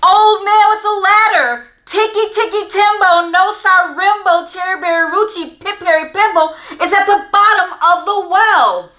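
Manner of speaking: very high, loud desperate shout; last-breath shout. Acted. Exhausted boy shouting to the old man, desperate. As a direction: give it everything you have left. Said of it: "with his very last bit of breath, he shouted".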